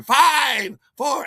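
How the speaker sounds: high, loud crowd countdown; big party-countdown yell; shouted countdown. Retold or imitated. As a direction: call out the countdown at full shout. Party crowd countdown overheard in story.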